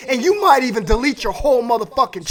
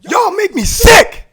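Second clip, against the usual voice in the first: disgusted shout; 6 semitones higher than usual. Real, not acted. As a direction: shout it slowly and higher. Shouted disgust, then repeated quietly.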